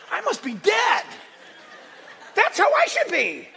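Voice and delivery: high, loud outburst; mock-anguished shout at yourself; hammed-up cry of self-judgement; exaggerated self-reproach. Acts out judging himself by others.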